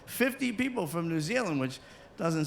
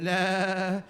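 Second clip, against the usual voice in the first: Kiwi nah. Retold or imitated. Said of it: "He said".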